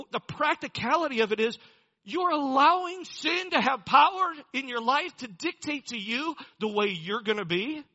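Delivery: full-voiced sermon climax; preaching at full force. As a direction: drive the point home to the congregation.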